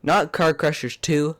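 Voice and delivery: ordinary talk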